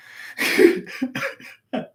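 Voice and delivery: breathy burst of laughter; real wheezy laugh. Real, not acted. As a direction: crack up after the bit. Cracks up after doing the voice.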